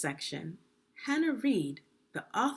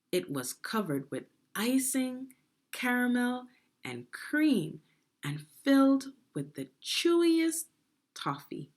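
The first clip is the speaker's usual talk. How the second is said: ordinary delivery, nothing marked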